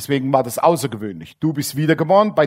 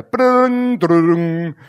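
imitated ringing bell; 4 dB louder than usual. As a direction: ring it out like the weekend bell. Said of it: "Da klingelt schon das Wochenende".

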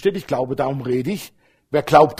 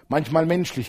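usual speaking voice

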